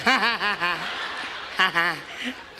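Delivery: long, staccato mock laugh; put-on old-man chuckle. Drunk host amused by own joke, hammed-up laughing. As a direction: laugh in character as a tipsy elder. Impersonated Mandela keeps laughing.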